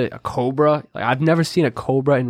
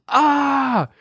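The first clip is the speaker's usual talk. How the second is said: mock scream; 13 semitones higher than usual. Retelling James screaming at the spider in the next room, high shriek. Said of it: "All I hear is".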